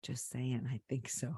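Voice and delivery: hushed, secretive voice; whispering. Real, not acted. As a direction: whisper so nobody overhears. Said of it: "Why are you whispering?".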